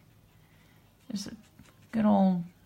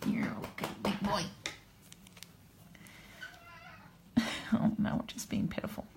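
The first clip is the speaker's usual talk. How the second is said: whisper; 8 dB quieter than usual. Real, not acted. Whispering to the cat on her lap.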